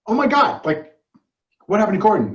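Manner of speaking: high, loud retold gasp of dismay; mock alarm. Retelling the girlfriend asking after Gordon, mock alarm. Retelling film character's panicked reaction.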